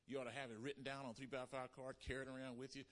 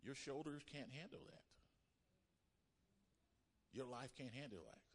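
God speaking gently; 8 dB quieter than usual. Retold or imitated. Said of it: "He said".

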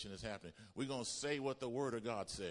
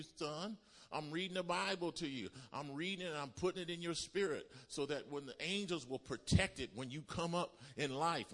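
raised, urgent preacher's call; fervent preaching; 4 semitones higher, 3 dB louder than usual. Acted. Preacher urging parents to read scripture, fervent preaching. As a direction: preach it out to the congregation.

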